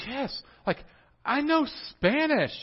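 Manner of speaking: high excited squeal; thrilled to know Spanish; proud student. Retold or imitated. Student brags to himself, high and thrilled. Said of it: "You were like".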